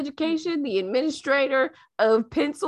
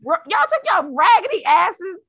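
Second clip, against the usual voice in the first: ordinary delivery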